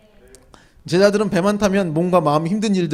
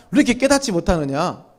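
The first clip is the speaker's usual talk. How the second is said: building to a loud, higher scolding shout; mock scolding; 5 semitones higher, 9 dB louder than usual. Pastor playing himself scolding the congregation, loud mock rebuke. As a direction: scold them as if they never listen. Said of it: "이러면서 제가 막".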